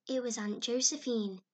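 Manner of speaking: usual speaking voice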